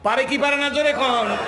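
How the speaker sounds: loud, high-pitched sermon shout; full-force preaching. Real, not acted. Preacher rousing the congregation to answer aloud, full-force shout.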